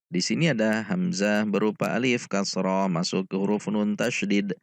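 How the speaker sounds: usual speaking voice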